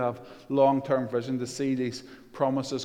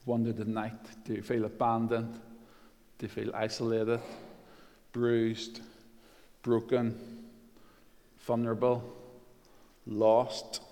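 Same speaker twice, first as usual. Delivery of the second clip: softer, with long pauses between words; tender appeal; 3 semitones lower, 5 dB quieter than usual. Real, not acted. Preacher asking the hurting if they feel lost, tender. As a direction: ask it softly, letting each word sit.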